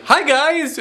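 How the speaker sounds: high bright "Hi, guys" with a falling tune; the old go-to "Hi, guys" greeting; habitual greeting. Shown as a demonstration. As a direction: sing out "Hi, guys" high and dropping. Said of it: "trying to get away from saying".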